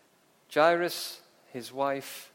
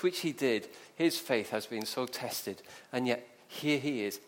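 ordinary talk, nothing marked

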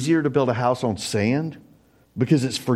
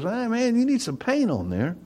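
lightly voiced friend Charlie, high and casual; a hint of a buddy pointing at bare siding; 11 semitones higher, 3 dB quieter than usual. Friend at the house, pointing out missing paint, lightly voiced. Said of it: "Charlie goes".

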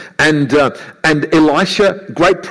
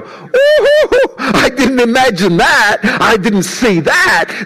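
high, laughing ooh of wonder; amazed servant; 7 semitones higher, slower than usual. Retold or imitated. Servant amazed at the fiery chariots, high laughing wonder. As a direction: gasp in delighted surprise. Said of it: "I can imagine him going".

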